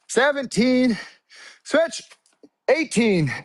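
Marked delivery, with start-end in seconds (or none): 1.6-2.0 high, punchy coach cue; called-out cadence. Real, not acted. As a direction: call each switch to keep the class on beat. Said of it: "When I say switch, you switch".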